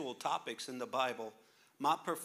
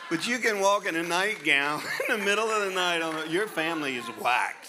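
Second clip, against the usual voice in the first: louder, higher laughing disbelief; laughing incredulous; 4 semitones higher, 9 dB louder than usual. Real, not acted. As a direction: laugh through the punchline in disbelief. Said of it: "mocking comment".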